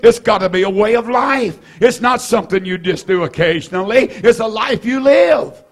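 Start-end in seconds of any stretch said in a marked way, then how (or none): 4.1-5.6 fiery preacher's climax; shouted preaching. Real, not acted. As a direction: drive the point home with a shout. Preacher hammering his point home.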